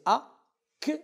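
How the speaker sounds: "A, que," with the k split off as its own syllable; wrong syllable split. Shown as a demonstration. Teacher shows class how not to divide accident, wrongly split syllables. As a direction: say "A, que," as two detached syllables. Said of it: "On ne va pas prononcer".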